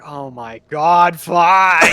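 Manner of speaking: loud, drawn-out exasperation; exasperated giving in. Acted. Player's character gives in to a panicked woman, exasperated. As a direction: groan it out loudly as you relent.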